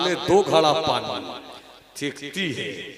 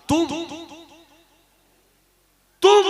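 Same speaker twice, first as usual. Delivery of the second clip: high, isolated bursts with long pauses; forcing out voice; 12 semitones higher, faster than usual. Acted. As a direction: struggle to force out a single word. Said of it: "पूरी ताकत लगाया, अचानक आवाज निकली". (मुश्किल से निकलती आवाज़)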